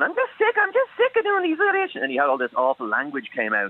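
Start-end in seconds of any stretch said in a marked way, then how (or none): 0.0-2.0 very high, squeaky little character voice; fed-up elf actor. Retold or imitated. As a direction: imitate a tiny elf griping in falsetto. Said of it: "he heard a guy going".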